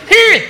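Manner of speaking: high, loud martial-arts "heh"; sharp punch cry. Acted. Imagined punch cry, acted out.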